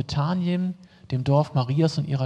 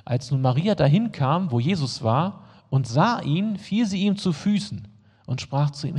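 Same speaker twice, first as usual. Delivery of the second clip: ordinary talk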